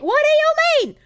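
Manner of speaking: high, loud indignant squeak; mock offended. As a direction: act wounded and shocked.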